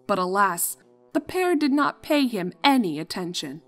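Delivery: ordinary delivery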